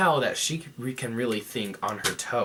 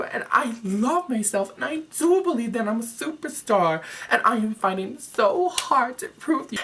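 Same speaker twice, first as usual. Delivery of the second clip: high, loud, tearful drama-queen breakdown; overacted sobbing plea to the judges, pitched high; dramatic reenactment of Laganja; 9 semitones higher, 4 dB louder than usual. Contestant breaking down on stage before the judges, high and overwrought. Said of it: "A dramatic reenactment of Laganja's breakdown on stage".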